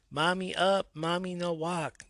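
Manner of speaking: put-on toddler voice. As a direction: imitate a baby asking up. Mother imitates toddler son.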